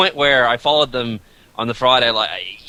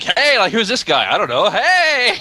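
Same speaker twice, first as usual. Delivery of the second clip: high, puzzled greeting; mock surprised onlookers; 10 semitones higher than usual. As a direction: imitate baffled people greeting a stranger. Imagining surprised onlookers on stage.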